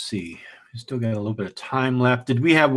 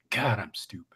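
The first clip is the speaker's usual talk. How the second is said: whispering under the breath; a breathy self-scolding whisper; whispered self-reproach; 3 semitones lower, 11 dB quieter than usual. Retold or imitated. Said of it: "you're just like".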